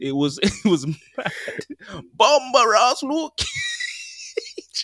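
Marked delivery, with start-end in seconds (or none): 2.1-4.8 slow, high, drawn-out mock Jamaican yell; mock Jamaican shout. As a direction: stretch it out in a hammed-up Jamaican accent. Mocking Luke Cage voice.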